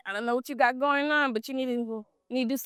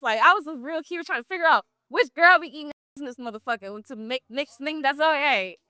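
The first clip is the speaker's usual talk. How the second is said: ordinary talk, nothing marked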